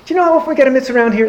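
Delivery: high-pitched, very fast pleading; excited coaxing. Acted. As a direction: rush it out like an eager farmer. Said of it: "The farmer got all excited".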